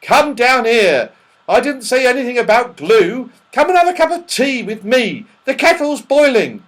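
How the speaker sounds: loud, high calling up; crying out. Acted. Mister What's-his-name inviting him to tea, calling loudly. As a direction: call an invitation up to someone deaf. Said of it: "cried Mister What's-his-name".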